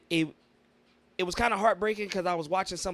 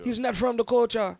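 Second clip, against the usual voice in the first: put-on Jamaican accent; 6 semitones higher, 6 dB louder than usual. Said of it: "was my Jamaican accent?".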